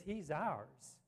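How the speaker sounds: ordinary talk, nothing marked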